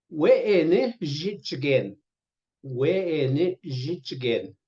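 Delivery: slow, syllable by syllable; drawn-out model pronunciation of "We'ene Jitchigen"; slow teaching pronunciation. Shown as a demonstration. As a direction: spell it out slowly for learners. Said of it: "Do it right".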